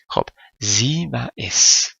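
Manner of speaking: ordinary talk, nothing marked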